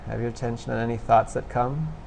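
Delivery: soft, low-key meditation guidance with long pauses; guided meditation. Real, not acted. Instructor guiding listeners to notice thoughts and sensations, quiet meditation voice. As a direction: gently prompt them, leaving long silences. Leads a meditation after breathing exercise.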